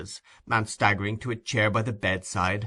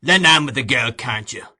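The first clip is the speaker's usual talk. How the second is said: loud, impatient bark; 7 dB louder than usual. A narrator's reading. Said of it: "said Sykes impatiently".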